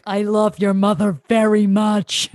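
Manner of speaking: flat menacing sincerity; mean voice. Retold or imitated. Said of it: "he always sounds mean".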